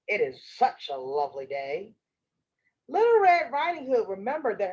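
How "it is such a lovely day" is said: low-pitched sly wolf. Acted. Wolf chats up the little girl, low wolf voice. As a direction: play the wolf, well below your own pitch. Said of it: "said the wolf".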